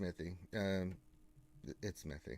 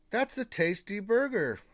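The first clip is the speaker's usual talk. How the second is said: movie quote; 7 semitones higher, 3 dB louder than usual. Acted. Continues the film quote.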